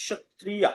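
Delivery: সত্রিয়া with initial sh; Sanskrit pronunciation. Shown as a demonstration. Teacher reminds students of this word's sound, সত্রিয়া with sh. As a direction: say সত্রিয়া as shat-triya. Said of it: "এরকম উচ্চারণ হবে".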